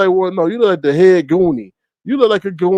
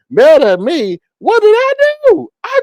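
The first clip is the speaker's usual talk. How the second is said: mock innocence; 13 semitones higher, 6 dB louder than usual. Streamer mock protesting to a rival, high whiny innocence. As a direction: play the wounded innocent, hammed-up.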